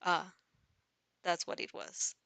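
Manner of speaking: usual speaking voice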